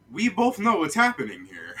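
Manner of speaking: knowing insinuation. Acted. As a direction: wink at him, we're in this together. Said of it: "he points at you and he goes".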